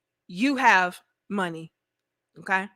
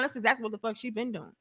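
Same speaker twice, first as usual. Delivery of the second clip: ordinary talk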